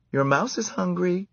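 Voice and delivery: high light aunt voice; a hint of a kindly aunt. A narrator's reading. Aunt telling the boy about his mouse, light high voice.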